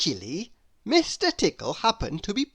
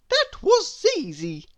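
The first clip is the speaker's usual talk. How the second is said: put-on very high, bright and louder little girl; Little Miss Somersault; 10 semitones higher, 4 dB louder than usual. A narrator's reading. Little Miss Somersault brushing off Mister Worry, very high and loud. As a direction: chirp it way up high. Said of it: "she said".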